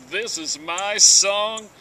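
ordinary delivery

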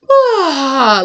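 sharp high loud gasp; mock gasp. Client as goddess, a high loud gasp. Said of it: "you were just like".

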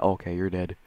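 normal talking voice

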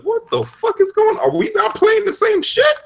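high squeaky mock confusion; high mocking voice, baffled; mock bewildered.

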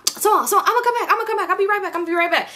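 high, repetitive mock pleading; imitated hasty promises. Boyfriend on the phone promising to return, high mock placating. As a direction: imitate him brushing her off in a high voice.

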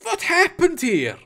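stunned character voice, high; shocked question. Acted. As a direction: ask it aghast, voice pitched well up. Eric staring at the dead crew.

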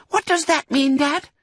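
put-on high, loud child voice; a cub asking its dad; curious fox cub. A narrator's reading. Fox cub to father, high curious voice.

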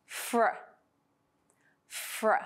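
"fr" said clearly, f running straight into r; clearer blend. Shown as a demonstration. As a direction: join "fr" with no vowel between. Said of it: "or more clearly".

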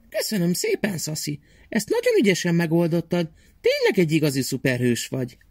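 put-on high, kindly old aunt voice. Old aunt gently thanks Szaszi, high kindly voice. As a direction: thank the little boy in a high old-lady voice.

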